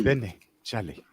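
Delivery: slow African accent demo. Shown as a demonstration. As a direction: spell out Benny, Charlie slowly in the accent. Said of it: "Just get it right".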